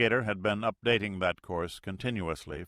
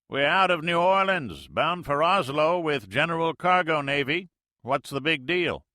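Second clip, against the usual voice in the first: folksy Mississippi skipper talk, loud; Mississippi accent; 9 semitones higher, 7 dB louder than usual. Acted. Major answers the Navy plane in Mississippi accent, loud and folksy. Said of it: "The major's Mississippi accent".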